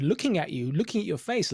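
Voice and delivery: ordinary talk, nothing marked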